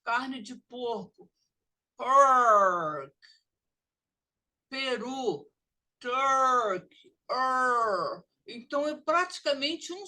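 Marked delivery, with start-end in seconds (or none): 2.0-3.2 pork said loud and drawn out; model word. Shown as a demonstration. Teacher modelling pork for students, loud. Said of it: "E o som é er". 6.0-8.2 turk then er, loud and held; model word. Shown as a demonstration. Teacher modelling turk for students, loud. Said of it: "E o som é er".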